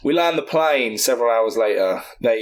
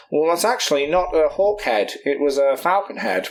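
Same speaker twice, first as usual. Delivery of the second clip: scholar voice; 4 semitones higher than usual. Retold or imitated. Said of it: "Ben, one of the scholars will be like".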